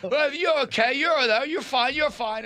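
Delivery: very high, hurried mock reassurance; imitating placating guide. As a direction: soothe him in a silly falsetto. Guide placating an angry jumper.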